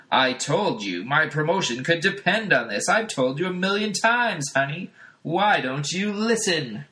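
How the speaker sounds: hammed-up annoyed TV husband; scolding sitcom husband. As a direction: nag her for forgetting.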